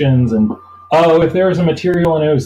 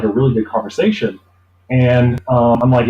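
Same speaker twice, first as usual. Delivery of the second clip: ordinary talk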